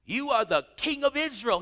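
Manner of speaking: giddy joyful praise. Retold or imitated. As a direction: gush like a thrilled convert. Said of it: "He got all giddy".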